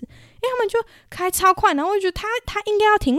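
panicky. Retold or imitated. Recalls fear of late braking.

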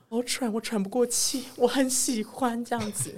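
mock the friend panting but delighted; imitating his friend. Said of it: "他说". (模仿朋友喘着说喜欢)

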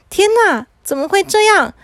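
high-pitched disbelief; shocked dismay. Acted. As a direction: exclaim in dismay at a sudden disaster. Boy shocked he cannot speak.

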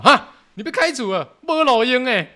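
a mother both stunned and fed up; mom's exasperated shock. Retold or imitated.

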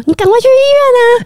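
a sudden alarmed burst, hurrying her; urgent urging. Retold or imitated. Friend urging her bleeding pregnant colleague to rush to hospital, alarmed fast high cry.

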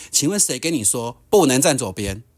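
normal talking voice

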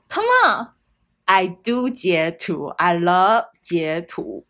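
slow, drawn-out teasing brag; playful boast. Real, not acted. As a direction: stretch it out like a cheeky confession. Playful boast about screenshots.

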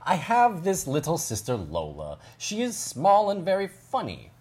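lilting storybook narration with wide pitch swoops; reading to children. Acted. Host reads Charlie's story to children, lilting narration. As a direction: read it as Charlie explaining his little sister, lively and measured.

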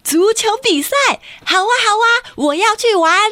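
put-on high, eager boy voice; excited boy. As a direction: jump at the offer like a thrilled kid. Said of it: "健太就说".

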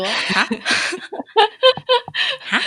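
laughing baffled huh. Retold or imitated. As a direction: react in laughing confusion. Retold baffled reaction.

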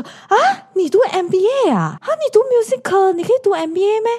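high, mocking disbelief, looking down on her; condescending tone. 学弟 mocking her music degree, a high condescending sneer. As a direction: sneer as if an arts student couldn't possibly do an MBA. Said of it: "看不起读art的人的那种语气".

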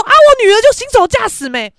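high, loud defensive excuse; mock indignant comeback; imitated bad attitude. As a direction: play the rude mother shielding her daughter. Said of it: "就开始语气超差".

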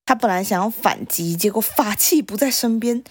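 plain reading voice

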